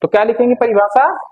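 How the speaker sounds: ordinary talk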